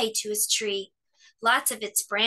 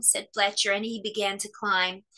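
ordinary delivery

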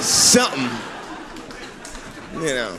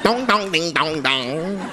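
mock Chinese speech; 5 dB quieter, slower than usual. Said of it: "they're like".

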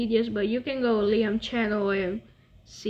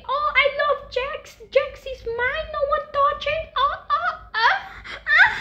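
swooning falsetto imitation; mock a possessive fan in a very high voice; squealing mock infatuation; obsessed fan hater. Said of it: "one hater saying".

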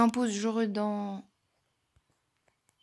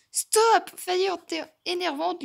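higher, louder exasperated yell; real irritated outburst; annoyed shout; 8 semitones higher, 5 dB louder, slower than usual. Real, not acted. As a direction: yell at her to stop. Annoyed at another player.

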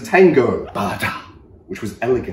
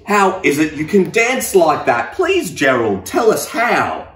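eager pleading; 6 semitones higher, 5 dB louder than usual. Acted. Animals begging Gerald.

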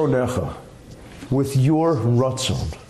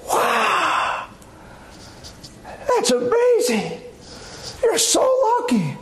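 much higher, slow, drawn-out awe; slower than usual. Retold or imitated. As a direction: gush like a wide-eyed southern believer.

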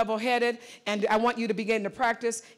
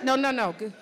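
usual speaking voice

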